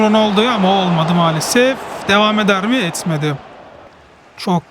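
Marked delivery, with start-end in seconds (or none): none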